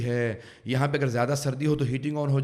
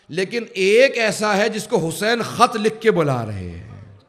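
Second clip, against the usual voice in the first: raised, ringing tribute in a higher pitch; impassioned preaching; 6 semitones higher, 7 dB louder than usual. Real, not acted. Preacher salutes Habib's greatness before the congregation, impassioned. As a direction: proclaim it loudly, drawing out key phrases.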